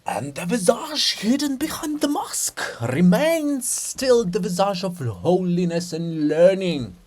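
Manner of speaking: slow, rapt pronouncement; lightly voiced enraptured monk; ecstatic. Methodius continuing in a slow, mildly rapt ecstasy. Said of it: "continuing in ecstasy".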